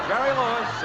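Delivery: usual speaking voice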